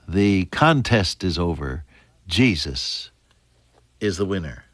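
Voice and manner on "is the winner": normal talking voice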